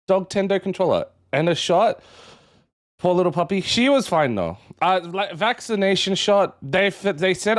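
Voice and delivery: usual speaking voice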